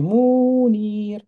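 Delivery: "Mounir" with both ou and i held very long; drawn-out vowels. Shown as a demonstration. Teacher reading back what a student wrote, exaggerated long vowels.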